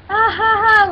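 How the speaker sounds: loud, forced mock laugh; fake laugh. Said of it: "she's like".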